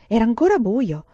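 ordinary delivery, nothing marked

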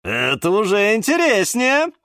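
greedy wizard perking up; perked up. A narrator's reading. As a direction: brighten at the offer. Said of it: "оживился".

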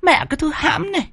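very high-pitched, rapid tirade; character voice of a cursing streetwalker; angry woman scolding. Acted. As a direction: lay into him in a high indignant falsetto. Startled woman scolds the old man.

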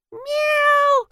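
very high, drawn-out cat howl; yowling cat. A narrator's reading. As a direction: wail like an outraged cat. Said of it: "завопил Альберт Кузьмич". (истошный кошачий вопль)

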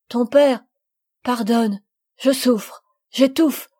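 dying mother choking on her last words. A narrator's reading. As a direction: push each word out through failing breath. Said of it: "Une convulsion lui coupa la parole".

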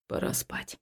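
breathy whisper, no voice. Acted. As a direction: murmur it close to her ear. Man tells her to sleep.

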